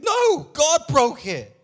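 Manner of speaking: loud, emphatic preacher's cry; full-force preaching. Acted. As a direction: hammer it home from the pulpit.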